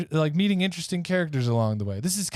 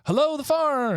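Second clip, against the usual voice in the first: calling out; 12 semitones higher than usual. Acted. Duke calls out to the farm.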